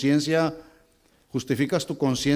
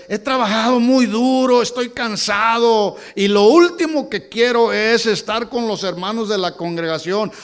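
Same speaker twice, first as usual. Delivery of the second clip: acted tired excuse, pitched higher and louder; excuse-making churchgoer; 9 semitones higher, 8 dB louder than usual. Preacher mimics a tired member excusing himself, higher and louder. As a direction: whine the excuse of someone who skips church. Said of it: "Y el argumento es".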